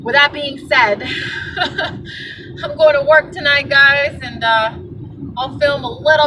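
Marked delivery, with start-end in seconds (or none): 1.1-2.6 breathy laugh. Real, not acted. Laughing after the rant.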